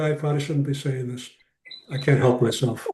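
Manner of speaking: ordinary talk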